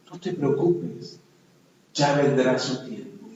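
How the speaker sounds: a gentle hint of the consoling wife; mild comforting. Wife gently reassuring her crying fiancé, soft.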